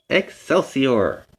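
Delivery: plain reading voice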